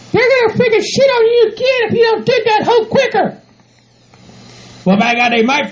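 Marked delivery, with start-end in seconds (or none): 0.1-3.4 high falsetto scolding voice; bossy partner. Acted. Bride telling groom to bury the manure, bossy. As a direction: lay down the law in a high put-on voice.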